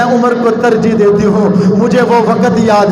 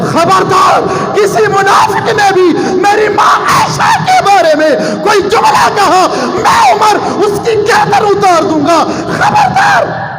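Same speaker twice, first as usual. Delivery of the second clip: high, shouted threat; furious warning; 10 semitones higher than usual. Acted. Umar warning the hypocrites about his mother Aisha, shouted high and furious. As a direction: roar it like a drawn sword.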